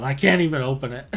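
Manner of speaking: usual speaking voice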